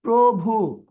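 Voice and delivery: প্রভু slowly split into two beats; syllable by syllable. Shown as a demonstration. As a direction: stretch both syllables of প্রভু. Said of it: "syllable পাচ্ছি কয়টা". (প্রভু দুই সিলেবলে টেনে, ধীরে)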